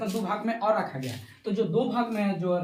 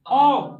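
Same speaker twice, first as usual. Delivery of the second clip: "औ" held long, higher and louder; drawn-out vowel; 4 semitones higher, 8 dB louder than usual. Shown as a demonstration. Teacher showing it takes more time, drawn-out "औ". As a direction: stretch "औ" out loudly. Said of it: "ज्यादा समय लग रहा है".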